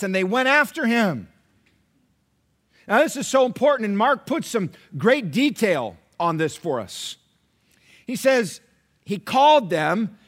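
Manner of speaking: loud, emphatic sermon retelling; fervent preaching. Acted. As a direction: drive the gospel story home. Preaching at full force.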